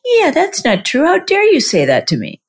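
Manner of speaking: high, huffy mock outrage; dog owner mimicking her puffed-up ego; puffed-up indignation. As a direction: chest pumped out, mock offended. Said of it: "you feel your chest gets kind of pumped out and you're like".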